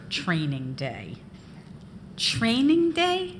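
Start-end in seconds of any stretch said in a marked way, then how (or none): 2.2-3.4 high, slow disbelieving echo; incredulous. A narrator's reading. Girl scoffing at the boss's excuse, incredulous. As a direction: repeat it as if you can't believe it.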